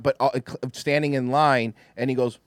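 usual speaking voice

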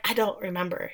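soft, low-key retold admission; evasive denial. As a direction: drop the voice, a small evasive answer. Retold evasive answer, then echoed back.

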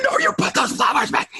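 sharp high shout to stop him; a dad yelling at his kid over a game; yelling at son. Retold or imitated. Dad yelling at his son to put the flowers back. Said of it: "I'm yelling at my son".

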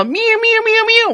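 high mock cat meows; meowing. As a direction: meow like a kitten, very high. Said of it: "I thought you were like".

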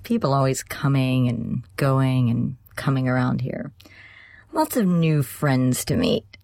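drawn-out, suggestive roommate voice; lascivious smile. A narrator's reading. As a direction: linger on it with a lewd smile. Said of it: "smiling lasciviously".

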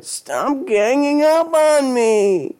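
high, slow, tearful wail; crying. Acted. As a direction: wail it through tears. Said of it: "Denny broke into tears".

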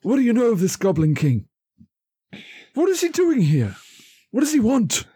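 high, fast, pressing questions; a worried showman grilling the room; squeaky puppet voice demanding answers about the Goblin King; [name] in character. Acted. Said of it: "he's just like".

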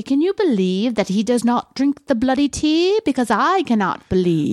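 hammed-up English lady voice; English accent. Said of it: "if I was English, it would be like this".

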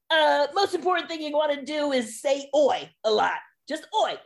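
high-pitched mock coach voice; Bonko the dialect coach. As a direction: lay down the one rule in a put-on voice. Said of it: "And he's like".

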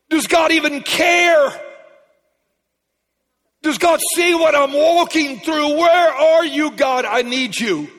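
loud, high desperate questions; crying out to God. Retold or imitated. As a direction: cry out to heaven as if abandoned.

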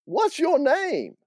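very high, drawn-out demand; exasperated Southern woman; slow, high-pitched annoyance; annoyed aunt. Acted. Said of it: "started to get really annoyed with this guy".

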